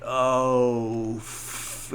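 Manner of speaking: long drawn-out vowel into a held f; slow-motion curse. Retold or imitated. Ralphie curses as the parts go flying, slow-motion. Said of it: "all in slow motion".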